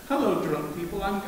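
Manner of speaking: ordinary delivery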